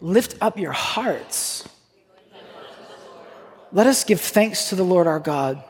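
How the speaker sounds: slow, measured litany with drawn-out words; liturgical call. Real, not acted. Pastor calling the congregation to give thanks, liturgical call. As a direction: lead the congregation in a stately call.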